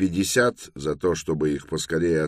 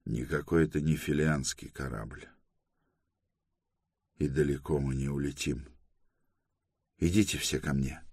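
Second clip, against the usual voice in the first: bitter; 5 dB quieter than usual. A narrator's reading. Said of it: "с горечью в голосе ответил".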